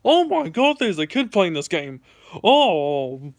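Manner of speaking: imitating the stranger's dismay; mock shocked stranger. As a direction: act stunned to find a kid. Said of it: "he was like".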